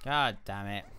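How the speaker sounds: ordinary talk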